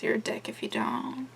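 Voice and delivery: breathy unvoiced whisper; whispered aside; whispered jab. Real, not acted. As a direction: slip in a sly whispered insult.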